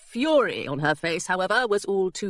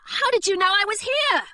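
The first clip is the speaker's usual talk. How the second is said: high, startled question; surprised girl; 12 semitones higher, 3 dB louder than usual. Acted. Hilary startled to see her old governess. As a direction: blurt it out in surprise.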